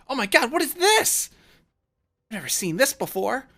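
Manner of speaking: very high, loud amazement; giddy character voice, bursting with excitement; all excited. Acted. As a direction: squeal in wide-eyed wonder. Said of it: "like, all excited".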